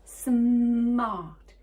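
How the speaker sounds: SMART with a long, loud held M; drawn-out, emphatic "smart"; careful word demo. Shown as a demonstration. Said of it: "let's not miss this one out".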